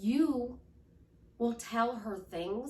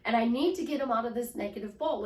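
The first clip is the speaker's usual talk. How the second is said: normal talking voice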